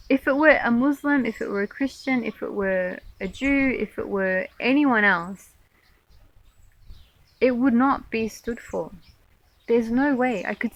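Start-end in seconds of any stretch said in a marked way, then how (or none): none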